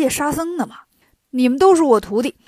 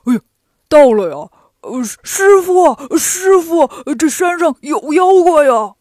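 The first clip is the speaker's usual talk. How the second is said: startled; 3 semitones higher, 8 dB louder than usual. A narrator's reading. Pigsie caught rehearsing, reporting monsters to his master, startled and flustered. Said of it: "八戒吓一跳".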